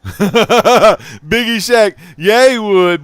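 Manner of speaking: laughing out loud, slow; big delighted laugh through the words; giggling hard while he talks. Real, not acted. Laughing while talking about wrestling.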